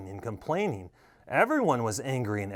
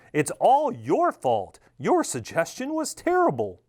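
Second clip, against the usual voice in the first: accusing; 6 semitones higher than usual. A narrator's reading. Governor blames the voice.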